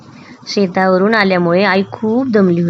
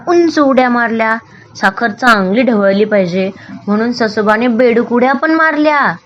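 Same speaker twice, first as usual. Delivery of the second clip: usual speaking voice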